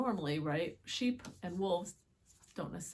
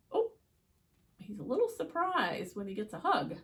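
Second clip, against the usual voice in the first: usual speaking voice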